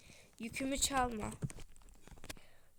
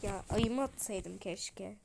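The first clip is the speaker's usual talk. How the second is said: usual speaking voice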